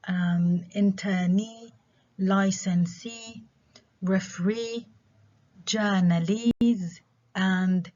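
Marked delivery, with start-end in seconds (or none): 0.7-7.0 internee, licensee, referee, journalese, each drawn out on its ending; stress last syllable. Shown as a demonstration. Teacher listing examples, internee to journalese with final stress. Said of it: "stress on the last syllable".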